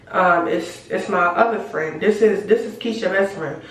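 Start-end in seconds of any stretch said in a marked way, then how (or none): none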